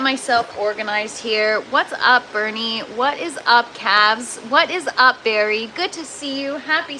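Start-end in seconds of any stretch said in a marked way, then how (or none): none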